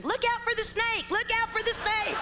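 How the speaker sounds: high hammed-up yell; mock scream. Granddaddy chasing kids in the backyard, high mock yell. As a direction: shriek it like a goofy old granddaddy.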